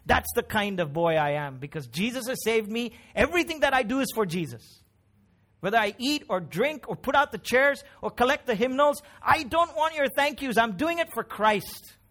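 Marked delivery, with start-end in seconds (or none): 1.9-12.0 high, fervent declaration from the pulpit; emphatic, raised preaching voice; impassioned preaching. Acted. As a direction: declare it with ringing conviction. Preaches in Timothy's voice.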